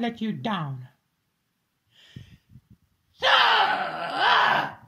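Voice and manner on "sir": high, loud barked call; sharp shout. Acted. Shouting for a servant to come. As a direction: snap out one word to summon him.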